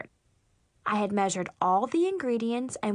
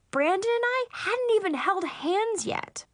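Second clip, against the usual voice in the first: put-on higher, appalled teen protest; appalled disbelief; 9 semitones higher than usual. A narrator's reading. Teen girl shocked by mom's baby talk, appalled. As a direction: protest it in high-pitched disbelief.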